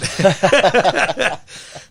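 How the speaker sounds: loud, high burst of laughter; real, full-out laughing; hearty laugh. Real, not acted. Guest laughing at own testimony, hearty laugh. Laughs after his punchline.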